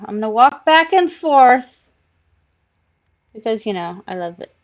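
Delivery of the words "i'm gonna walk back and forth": louder playful cat voice; mock cat voice. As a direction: voice the cat strutting proudly. Voicing the cat's thoughts.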